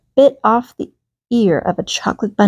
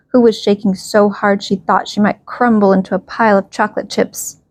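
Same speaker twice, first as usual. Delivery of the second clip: plain reading voice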